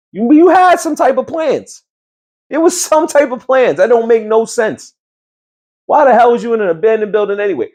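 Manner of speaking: high, exasperated disbelief; incredulous rant. Real, not acted. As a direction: rant in disbelief at her choices. Ranting at the character.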